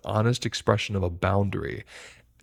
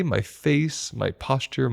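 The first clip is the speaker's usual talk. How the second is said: ordinary talk, nothing marked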